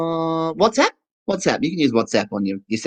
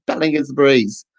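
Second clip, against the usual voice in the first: mock distracted caller; 8 semitones higher than usual. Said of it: "People on the phone are going".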